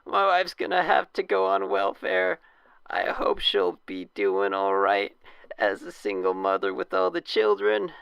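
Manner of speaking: high, loud dying wail; wounded soldier gasping. Acted. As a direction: moan it out like a dying man. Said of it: "For a dying man".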